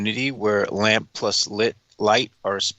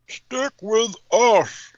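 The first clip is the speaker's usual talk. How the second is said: high, slow mocking voice; Trump impression; 11 semitones higher, 3 dB louder, much slower than usual. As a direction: drag it out in exaggerated parody. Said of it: "he insisted".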